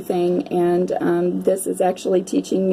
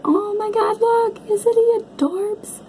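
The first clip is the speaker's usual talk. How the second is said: much higher, slow cooing over the picture; cooing delight; 12 semitones higher, slower than usual. Real, not acted. Reader showing viewers an adorable card, high cooing. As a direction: coo at it like a cute puppy.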